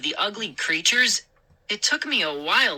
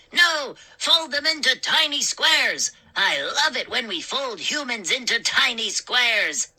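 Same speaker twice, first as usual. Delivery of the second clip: high, delighted gnome voice; gleeful gnome; 7 semitones higher than usual. A narrator's reading. A gnome eagerly pitches folding the humans, high and delighted. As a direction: relish the cruel idea.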